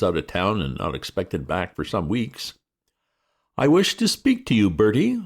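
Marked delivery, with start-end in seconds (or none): 3.6-5.2 high, loud imperious aunt; aunt sweeping in, loud and high; stern aunt. A narrator's reading. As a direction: demand to be heard at once. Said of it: "said the family curse".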